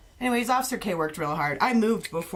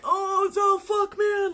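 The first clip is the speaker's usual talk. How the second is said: much higher, slow pained whine; beaten kids groaning; 13 semitones higher, 4 dB louder, much slower than usual. Retold or imitated. Beaten gang kids limping home, high slow whine. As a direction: drag it out, sorry for yourself. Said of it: "all these kids start coming back, like".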